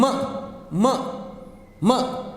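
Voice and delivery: "M" repeated as short muh; bare m sound. Shown as a demonstration. Teacher repeating the sound for learners, M as muh. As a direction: sound out each "M" separately, lips first, not em. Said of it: "It's M".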